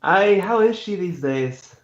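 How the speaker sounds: slow, friendly Scottish brogue; Scottish accent. Acted. Knight asking a friend about his mother, Scottish accent. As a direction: ask after his mother in a Scottish lilt. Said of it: "Can't handle your fucking accents".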